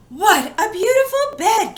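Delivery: high, breathless delight; thrilled little-girl awe; gasped delight. Acted. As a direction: gasp it out in wonder. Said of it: "gasped the girl".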